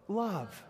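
"Love" said slowly and heavily with a falling tune; weighty love. Shown as a demonstration. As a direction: let the word "Love" sink with weight. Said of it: "Just say the word love".

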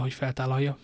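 ordinary delivery, nothing marked

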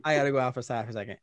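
rushed. Retold or imitated. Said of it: "I got up from the table".

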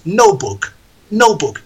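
"notebook" with the t dropped, no-book; no t sound. Shown as a demonstration. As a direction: swallow the t in "notebook". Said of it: "no la he dicho".

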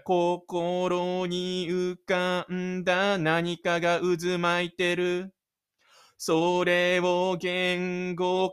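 slow, drawn-out poetic reading; dramatic narration. A narrator's reading. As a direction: linger on each phrase like an opening monologue. Dramatic inner-voice passage.

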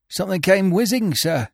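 a shaken groom answering his master; a hint of a hurt groom; injured groom. A narrator's reading. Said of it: "groaned feebly".

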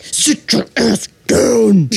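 slow, stretched-out scolding order; mom's voice. Retold or imitated. Reenacting how she told off her date, drawn-out mom voice. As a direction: replay a mother's firm command, word by word. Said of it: "my mom's voice came out of me".

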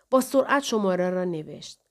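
ordinary delivery

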